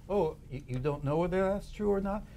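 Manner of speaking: acted mild, innocent surprise; mock interviewer. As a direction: gently catch the inner critic out. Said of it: "you interview them as if".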